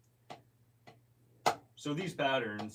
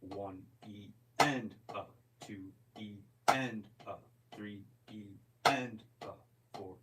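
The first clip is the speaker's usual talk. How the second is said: slow sixteenth count, loud to soft; slow drummer's count, loud note then soft note; slow drum count. Shown as a demonstration. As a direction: count the subdivisions slowly, accent then soften. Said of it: "So a loud note to a soft note".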